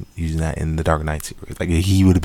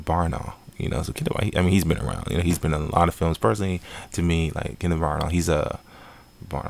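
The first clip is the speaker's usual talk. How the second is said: normal talking voice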